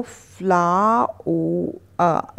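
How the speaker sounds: फ्लाओ- अ drawn out slowly; broken into sounds. Shown as a demonstration. Teacher breaking the word down, slow फ्लाओ- अ. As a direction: stretch "flaa-o-uh" sound by sound. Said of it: "जल्दी से बोलेंगे तो ये सही".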